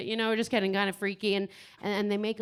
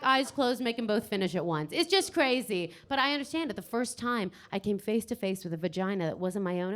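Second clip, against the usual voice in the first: ordinary talk, nothing marked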